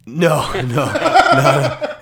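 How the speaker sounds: laughing it off, no, no; denying through real laughter. Real, not acted. Laughing denial at the guest's joke.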